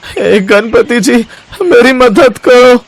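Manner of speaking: high, slow pleading to the god; pained plea. Acted. Fallen Shyam calling on Ganpati, slow pained plea. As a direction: beg Ganpati for help through the pain. Shyam prays after his fall.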